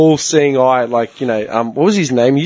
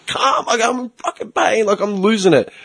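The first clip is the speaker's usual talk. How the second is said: exasperated protest, retold; 7 semitones higher than usual. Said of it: "Can you calm down?".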